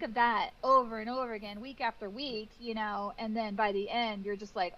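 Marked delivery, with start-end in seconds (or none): none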